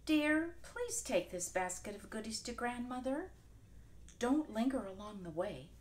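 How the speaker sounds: put-on a caring mom sending her girl off; lightly voiced mother; mother's voice. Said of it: "mother said".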